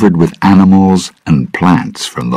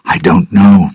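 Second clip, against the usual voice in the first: ordinary delivery, nothing marked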